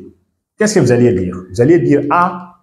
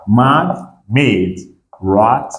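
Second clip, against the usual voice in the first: normal talking voice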